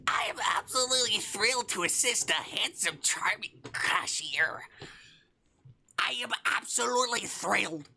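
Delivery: high raspy comedian squawk, partly whispered; Gilbert Gottfried impression. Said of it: "Gilbert Gottfried impression".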